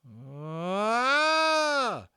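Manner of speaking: high, loud, drawn-out terrified scream; a moan that ends in a scream; the smallest skinhead screaming in horror; long scream. A narrator's reading. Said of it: "ended in a scream".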